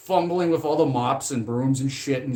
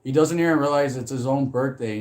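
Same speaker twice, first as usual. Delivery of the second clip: ordinary talk, nothing marked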